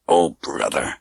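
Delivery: low, pained, teeth clenched; through clenched teeth. Acted. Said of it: "he said through clenched teeth".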